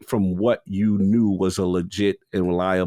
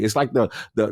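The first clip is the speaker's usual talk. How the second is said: normal talking voice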